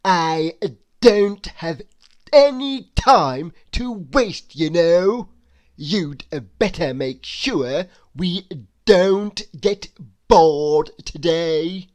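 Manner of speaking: loud, slow, haughty complaint; rude demand. A narrator's reading. Rude guest loudly demanding entertainment. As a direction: lay down the law to the host, loud and slow.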